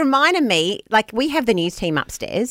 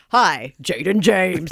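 slow, lower newsreader greeting; news anchor voice; 4 semitones lower, much slower than usual. Retold or imitated. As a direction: greet him like the evening news. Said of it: "I just wanna say".

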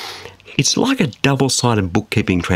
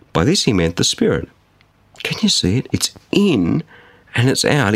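ordinary talk

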